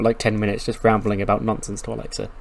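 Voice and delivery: ordinary delivery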